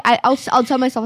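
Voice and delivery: ordinary talk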